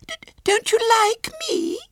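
very high, stammering near tears; tearful little girl. A narrator's reading. Small girl begs to be liked, tearful squeal. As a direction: plead in a tiny, quivering child voice. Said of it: "Her lips quivered".

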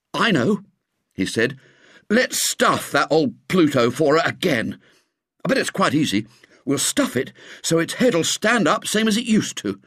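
put-on a boy sure of his idea; lightly voiced eager boy. A narrator's reading. William proposing to restuff the cat, eager boy voice.

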